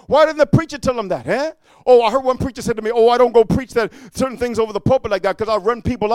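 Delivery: high, loud pulpit exhortation; full-force preaching. Real, not acted. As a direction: drive it like a fiery preacher, phrase after phrase.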